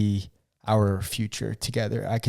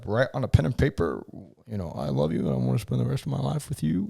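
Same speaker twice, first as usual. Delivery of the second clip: quick, mock-sappy recital; lightly put-on politician voice; Bill Clinton; 3 semitones higher, faster than usual. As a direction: rattle off a canned love speech. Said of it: "Bill Clinton right there".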